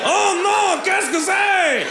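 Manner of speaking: high, hammed-up dismay with a French question; mock panicked teen. Comic teen panics at the French teacher, high and mock-dismayed. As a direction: play a lost teenager thrown into French.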